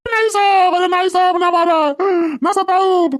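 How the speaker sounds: high, begging wail; hammed-up old man crying no; pleading cries. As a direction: plead for mercy under the stick. Old man begs inspector to stop.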